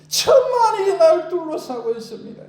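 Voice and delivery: desperate cry. Retold or imitated. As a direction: wail it out to God. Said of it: "안타깝게 부르짖는 것". (애타게 부르짖는 외침)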